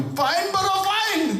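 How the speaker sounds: very high, hammed-up cry; stern demand. Government fining the pilot, acted insistent hollering. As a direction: call out the penalty at a high pitch.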